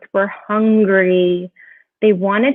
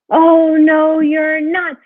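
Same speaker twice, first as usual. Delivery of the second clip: higher, emphatic troll refusal, loud at first; storybook troll; 6 semitones higher, 4 dB louder, slower than usual. A narrator's reading. Troll refusing the little goat, emphatic. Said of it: "said the troll".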